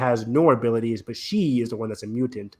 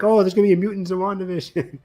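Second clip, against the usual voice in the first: higher-pitched imitation of excited fans; mock fan hype; 7 semitones higher, 4 dB louder than usual. Host imitating fans hyping a WandaVision mutant reveal, mock excitement. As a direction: play up the overhyped speculation. Mimics fans' hype before show.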